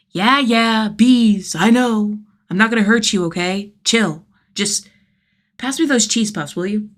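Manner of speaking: put-on calm the kid down, casual; Jason placating. Jason's dialogue.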